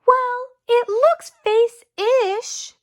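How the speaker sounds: high, slow little sister voice; drawn-out girlish exclaim. Acted. Little sister defending her drawing, high and slow. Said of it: "she exclaimed".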